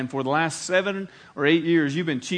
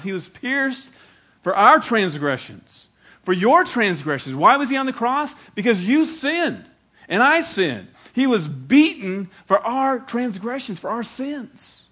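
louder and higher, driving each phrase home; rising preaching shout; 5 semitones higher, 6 dB louder, slower than usual. Real, not acted. Preacher to congregation, rising to a shout. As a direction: preach it at full force, slowly.

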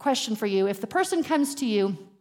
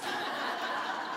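breathy laugh; 9 dB quieter than usual. Real, not acted. Laughing at her own misreading.

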